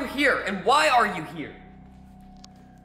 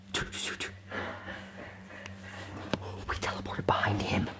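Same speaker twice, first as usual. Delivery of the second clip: whispering; 13 semitones lower, 12 dB quieter than usual. Acted. Hiding behind the monster.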